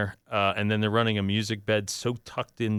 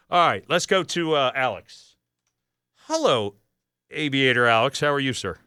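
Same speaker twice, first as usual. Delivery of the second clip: ordinary talk, nothing marked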